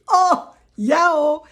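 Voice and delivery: high, loud thrilled "oh yeah"; delighted exclaim. Real, not acted. Showing viewers her birth certificate, high and loud with delight. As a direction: burst out with joy at the document.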